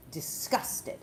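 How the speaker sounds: mock disgust, half-voiced word; lightly acted revulsion. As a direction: let the word out with distaste. Acts onlookers' disgusted look.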